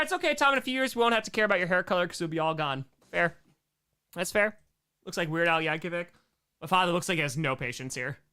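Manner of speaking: ordinary talk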